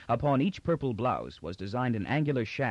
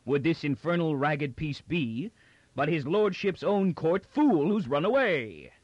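heavy guard voice; 6 semitones higher, 3 dB louder than usual. Acted. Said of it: "continued the guard heavily".

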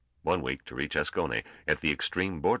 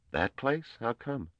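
a touch higher, puzzled youngster; mild surprise; 9 semitones higher, slower than usual. A narrator's reading. Gorm hearing the destination, mildly surprised. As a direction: lift it with light disbelief.

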